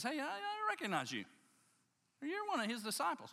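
high-pitched voice of a man recognizing someone; accusing man. Acted. Man spots Peter and accuses him, high and insistent. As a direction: act out a startled accuser, pitched well up.

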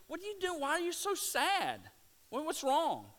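high, caring voice asking what's wrong; gentle questioning Jesus. Retold or imitated. Jesus asking the sad travellers on the road, high and caring. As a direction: lift way up, concerned and gentle.

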